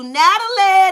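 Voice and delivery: ordinary talk, nothing marked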